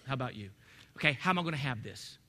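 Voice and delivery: normal talking voice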